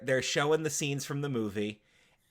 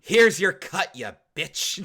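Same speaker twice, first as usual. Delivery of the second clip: mock angry jab; 6 semitones higher, 9 dB louder, slower than usual. Ex-wife whips money at her.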